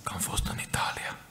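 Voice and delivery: whispered confidence to the congregation. Real, not acted. Preacher confides his travels to the church, whispering. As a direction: hush it like a secret.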